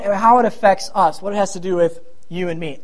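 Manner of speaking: normal talking voice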